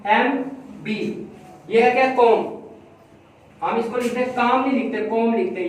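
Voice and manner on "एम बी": comb said, then each letter slowly; slow classroom spelling. Shown as a demonstration. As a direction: dictate the letters slowly for a child. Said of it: "कॉम की spelling क्या लिखते हैं हम?".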